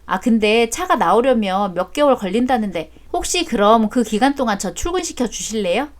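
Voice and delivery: ordinary delivery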